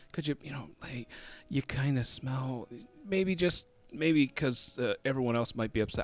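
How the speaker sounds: soft, hesitant imitation of gentle sister; hushed, halting tact; nice, tactful. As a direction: tiptoe round it quietly, trying not to embarrass. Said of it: "really nice about it and trying not to embarrass him".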